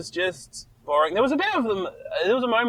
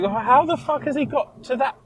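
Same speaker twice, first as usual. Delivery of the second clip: climbing higher to a confused what; baffled disbelief; 9 semitones higher than usual. Retold or imitated. Host reacting to the film's leaps, rising baffled higher. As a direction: disbelief at a plot jump. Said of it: "Where I was like".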